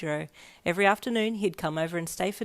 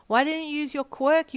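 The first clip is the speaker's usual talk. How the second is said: high, louder demanding question; emphatic questioning; 9 semitones higher, 5 dB louder than usual. A narrator's reading. Friend quizzing him after he took the hits, emphatic. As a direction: press the question home with concern. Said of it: "you quizzed him emphatically".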